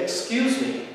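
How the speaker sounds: a high, rising "Excuse me" that stands its ground; firm excuse me. Shown as a demonstration. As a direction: say "Excuse me" so nobody runs you over. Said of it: "to introduce yourself to someone as not being easy to run over".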